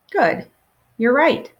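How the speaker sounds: ordinary delivery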